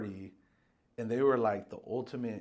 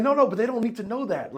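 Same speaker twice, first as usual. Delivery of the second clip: high, loud brush-off as the boss; dismissive boss; 10 semitones higher, 9 dB louder, faster than usual. Retold or imitated. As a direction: wave away the worry, loud and high. Voicing boss brushing off concern.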